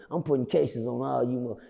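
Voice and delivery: lightly voiced Denzel Washington; Denzel impression. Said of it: "In my Denzel Washington voice, in my Training Day voice".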